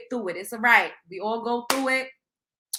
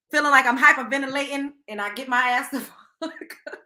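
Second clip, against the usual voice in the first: ordinary delivery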